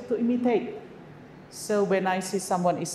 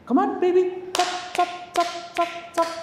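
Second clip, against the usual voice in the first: put-on high, bouncy chop chop to a child; playful hurry-up; 9 semitones higher than usual. Shown as a demonstration. Teacher hurries a small child along, high playful chop chop. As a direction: chirp chop chop like a game to speed them up. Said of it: "We modify".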